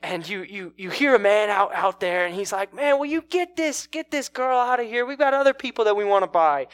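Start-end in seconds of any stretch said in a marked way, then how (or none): 2.7-6.7 high, loud shout from the crowd; acted impatient heckler. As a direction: call out irritably to hurry things along.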